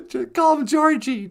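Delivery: ordinary talk, nothing marked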